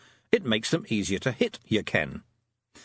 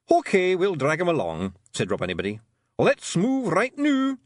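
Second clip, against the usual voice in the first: raised gruff little Scots pixie, pleased with himself; boastful wee Feegle, a bit louder; Rob Anybody's Scots voice; 6 semitones higher, 4 dB louder than usual. A narrator's reading. Rob Anybody boasting to Tiffany after the fight, Scots pixie voice. Rob's voice.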